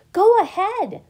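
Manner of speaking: high, loud, eager urging; excited. A narrator's reading. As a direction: egg a friend on excitedly. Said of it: "the pollywog said excitedly".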